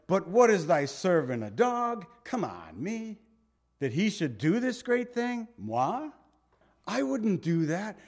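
mock incredulous. Preacher acts Hazael answering the prophet, incredulous. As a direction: protest in high-pitched, exaggerated astonishment.

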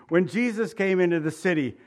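normal talking voice

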